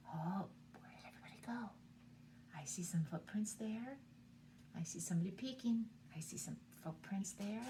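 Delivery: soft unvoiced searching whisper. Teacher whispering while searching for hiding animals. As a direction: tiptoe whisper looking for sleepers.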